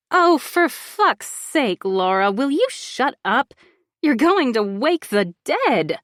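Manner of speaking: loud, exasperated, slow at first; irritated snapping. Acted. Bronwyn snapping at Laura to stop screaming, exasperated. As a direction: snap at her to shut up.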